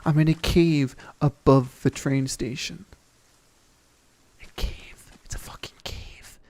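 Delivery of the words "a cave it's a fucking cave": hushed, awed whisper. Real, not acted. Exploring a hidden cave, whispering in awe to viewers. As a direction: breathe it like a secret.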